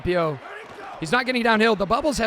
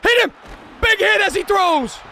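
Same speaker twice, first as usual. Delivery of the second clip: high, loud hype yell; excited shout; 10 semitones higher, 7 dB louder than usual. Real, not acted. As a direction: roar at the big hit. Yelling at a big hit.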